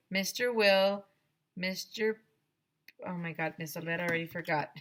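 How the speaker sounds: ordinary delivery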